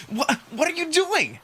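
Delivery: high, slow startled question; confused protest; bewildered. Acted. Margot grabbed by Amicus, bewildered protest.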